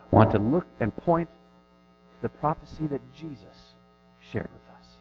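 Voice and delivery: normal talking voice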